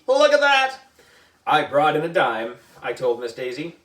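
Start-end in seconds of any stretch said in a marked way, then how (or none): 0.1-0.8 high, loud delight; excited. Acted. AJ admiring his lucky dime, high excited voice. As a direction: marvel at it out loud.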